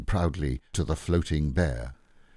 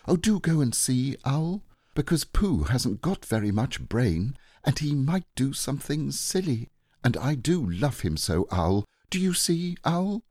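put-on high, pleading boy's voice; anxious Christopher Robin; 9 semitones higher than usual. Boy begging Owl to find Pooh, high and anxious. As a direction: plead earnestly as a worried boy.

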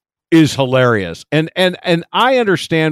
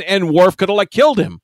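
usual speaking voice

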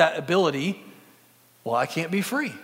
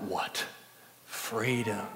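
weak murmur; 5 semitones lower, 8 dB quieter, much slower than usual. Retold or imitated. Dying Wallace quote in Braveheart.

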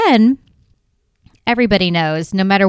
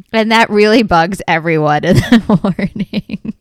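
1 laughing; 3 dB louder than usual. Real, not acted. Laughing while speaking.

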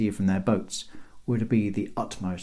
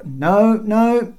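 high, loud interruption; called-out refusal; 12 semitones higher, 8 dB louder than usual. A narrator's reading. Guards cutting Bob off, calling out. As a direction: cut him off from above. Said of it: "called out the four".

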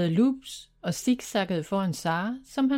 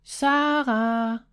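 a mild raised call of her name; calling louder; 3 semitones higher than usual. A narrator's reading. Brother searching the empty bedroom, mildly louder call. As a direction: call out a bit louder, worried. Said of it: "Han kaldte højere nu".

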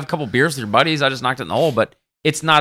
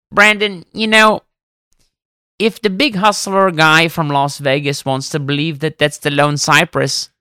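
Elmar; 3 semitones higher than usual. Retold or imitated. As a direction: imitate a caddie friend patiently setting someone straight. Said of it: "He goes".

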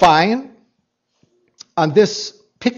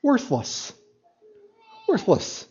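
softly murmured Worthless, then again softer; self-condemning murmur; 8 dB quieter than usual. Retold or imitated. Criminal judging himself, quiet self-condemning murmur. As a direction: let Worthless sink out almost unheard. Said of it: "gazed at himself and go".

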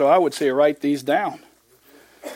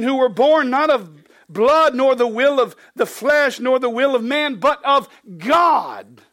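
raised, ringing scripture read; 6 semitones higher, 3 dB louder than usual. Acted. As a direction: proclaim the verse to the congregation. Reads scripture aloud.

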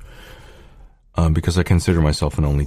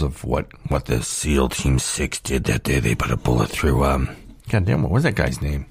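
talking between sips of soda; drinking soda while talking; while sipping a drink; 4 semitones lower, 4 dB quieter than usual. Real, not acted. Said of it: "Sorry, I'm drinking".

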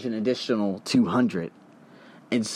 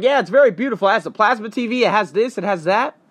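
eager pitch; 7 semitones higher than usual. Retold or imitated. Scammer pitching the room.

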